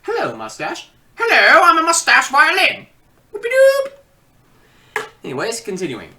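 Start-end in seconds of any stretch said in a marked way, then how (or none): 1.2-2.9 silly mustache voice. Acted. Voicing a talking mustache character. 3.3-5.1 goofy cheer. Acted. Mustache character cheering goofily.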